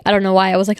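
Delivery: ordinary talk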